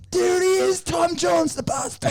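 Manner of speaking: high shouted Aussie pub cheer. Retold or imitated. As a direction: yell at the telly like a fan in a pub.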